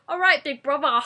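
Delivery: a loud mock Essex greeting; Essex accent. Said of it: "that is the best accent in the world".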